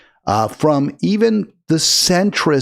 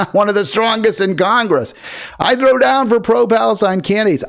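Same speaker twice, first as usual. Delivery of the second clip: higher, hammed-up self-praise; mocking boast; 7 semitones higher than usual. The host throws her boast back at her in a high mocking voice. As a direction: repeat the brag with open mockery.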